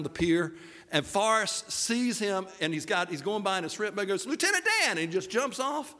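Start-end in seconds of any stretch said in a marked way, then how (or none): 4.3-4.9 high-pitched, eager calling out. Acted. Sees Lieutenant Dan on the pier, excited Gump impression. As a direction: holler it up high in character. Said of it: "That's my Forrest Gump".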